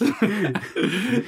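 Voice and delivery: short high rising laugh; a quick chuckle. Real, not acted. Laughs after compliment.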